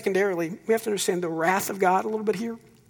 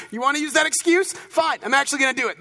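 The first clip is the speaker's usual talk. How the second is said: higher, quick mock threat from God; mock God's voice; 7 semitones higher, 4 dB louder, faster than usual. Preacher mocking a childhood fear, voicing a spiteful God. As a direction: play a petty God snapping back. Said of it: "Like, you know".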